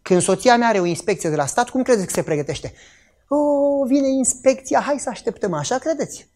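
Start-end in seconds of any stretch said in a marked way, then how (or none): 3.3-5.5 higher-pitched mock casual quote; mock nonchalance. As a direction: play at carelessly waiting for the inspection. Mocking a relaxed pre-inspection attitude.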